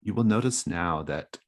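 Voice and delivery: ordinary talk, nothing marked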